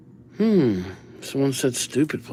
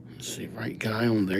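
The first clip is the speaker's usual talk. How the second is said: whisper; 4 semitones lower, 10 dB quieter than usual. Real, not acted. Muttering to himself while writing.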